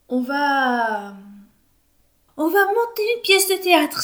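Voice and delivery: a high, loud blurted cry; exclaiming. A narrator's reading. Justine blurting out the theatre idea to everyone, exclaiming. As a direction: burst out with the idea. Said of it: "s'écrie Justine".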